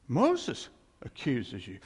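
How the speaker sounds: ordinary talk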